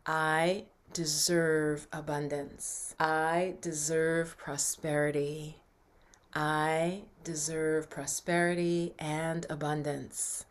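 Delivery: slow, measured affirmation. Shown as a demonstration. Coach models an affirmation for viewers, slow and deliberate. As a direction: say it slowly, spacing it out for others to repeat. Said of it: "what I'd want you to affirm".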